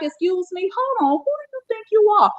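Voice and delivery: high-pitched mock indignation; Monique. Critic mimics the offended comedian, high incredulous challenge. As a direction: imitate an affronted star, pitch jumping up. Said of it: "It's like".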